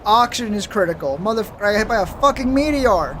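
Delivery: loud, outraged yelling; real outburst after being hit; angry shout. Real, not acted. Hit by a meteor, cursing.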